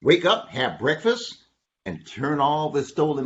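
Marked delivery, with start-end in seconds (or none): none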